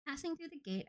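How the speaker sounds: plain reading voice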